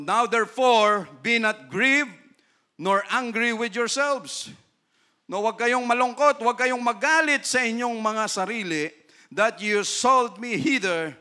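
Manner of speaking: loud, slow solemn Bible reading; scripture reading. Acted. Pastor reading Genesis aloud to the church, in a solemn, ringing voice. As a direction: proclaim the verse to the congregation.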